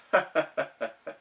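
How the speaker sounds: soft laugh; doctor chuckle. A narrator's reading. The doctor laughing at worried SpongeBob, soft. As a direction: chuckle kindly. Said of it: "The doctor laughed".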